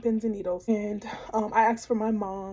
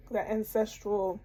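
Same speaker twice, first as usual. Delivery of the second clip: ordinary talk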